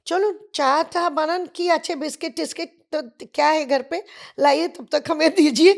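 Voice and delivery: loud, high, jovial ordering about; hearty demand. Retold or imitated. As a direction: boss the hostess around cheerfully. Ray demands tea and biscuits. (माँ से चाय माँगते हुए ज़ोरदार, ख़ुशमिज़ाज आवाज़)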